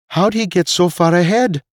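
high, gaping disbelief; astonished. A narrator's reading. Veek seeing Nate leap half a mile, high astonishment. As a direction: pitch it way up in amazement. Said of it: "gaped Veek".

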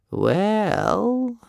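put-on high, drawn-out old croak. A narrator's reading. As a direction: croak it out reluctantly. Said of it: "he croaked".

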